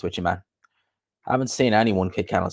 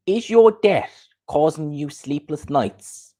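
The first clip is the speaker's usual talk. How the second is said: slow, higher-pitched advert voice; mock ad intro; 5 semitones higher, slower than usual. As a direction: stretch the question like an ad intro. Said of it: "that intro was ki".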